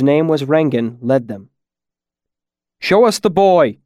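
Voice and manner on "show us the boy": raised, higher-pitched demand; yell. A narrator's reading. The town smith at the house leading a crowd, yelling. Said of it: "he yelled".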